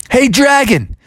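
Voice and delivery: high, loud goading shout; sing-out challenge across a distance; taunting call. Acted. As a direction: call out to bait the dragon. Taunting the dragon from afar.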